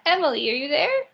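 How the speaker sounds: ordinary talk, nothing marked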